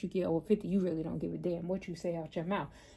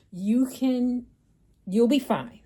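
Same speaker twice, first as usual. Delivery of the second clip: much louder, firm you'll be fine; emphatic reassurance; 4 semitones higher, 9 dB louder, slower than usual. Real, not acted. Urging viewers to get mammograms, loud firm reassurance. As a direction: drive the comfort home.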